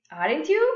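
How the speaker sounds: "Aren't you" with the pitch going up on "you"; rising intonation. Shown as a demonstration. Teacher repeats the tag for students, rising intonation. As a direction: swing "you" upward as a checking question. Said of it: "It goes up".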